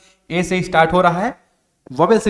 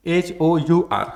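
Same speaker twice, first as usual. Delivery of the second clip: slow spelling out; much slower than usual. Shown as a demonstration. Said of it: "अब क्या पढ़ोगे इसको".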